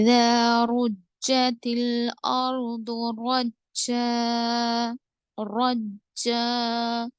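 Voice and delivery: slow, drawn-out tajweed chant; Quranic recitation. Shown as a demonstration. Tajweed teacher reciting for students, slow measured chant. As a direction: recite the verse slowly with elongated vowels.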